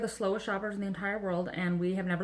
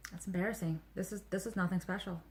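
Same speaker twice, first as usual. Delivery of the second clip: deflated; 3 semitones lower, 8 dB quieter than usual. Retold or imitated. Telling viewers about her Wawa letdown, deflated. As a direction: lower volume, underwhelmed aside. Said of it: "I was like".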